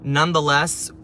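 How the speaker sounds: ordinary talk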